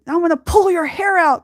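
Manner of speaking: high, loud playful threat from mom; mom's old threat, hammed up; mock threat. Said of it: "what she used to say".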